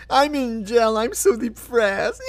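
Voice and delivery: high, slow whiny voice; hammed-up self-pity; mock whining inmate. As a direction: whine like a sulking child.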